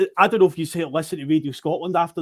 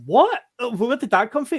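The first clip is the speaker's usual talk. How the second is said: retold disbelief; 7 semitones higher, 3 dB louder than usual. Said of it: "And I was like".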